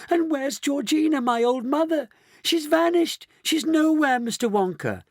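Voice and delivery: high-pitched frantic wail. A narrator's reading. Daughter to Mister Wonka about vanished mother, wailing. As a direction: wail in mounting panic. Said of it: "wailed Missus Bucket".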